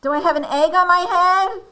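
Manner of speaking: high, loud storybook Elephant; alarmed question. Acted. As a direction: ask it in rising alarm. Elephant dreads an egg.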